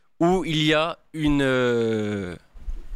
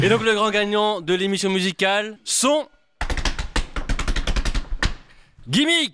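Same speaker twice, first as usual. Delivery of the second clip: booming prize announcer; 4 semitones higher than usual. Acted. Announcing music category winner.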